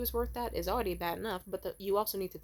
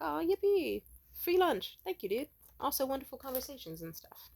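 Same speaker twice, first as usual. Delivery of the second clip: mock glee; 6 semitones higher than usual. Mock cheerful reaction to free lunch.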